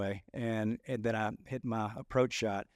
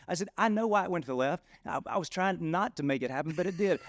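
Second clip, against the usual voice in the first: sarcastic anger; 7 semitones higher than usual. Retold or imitated. Angry golfer answering his friend's advice, high and sarcastic. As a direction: let the frustration rise, pitched high. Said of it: "He got real sarcastic with his anger".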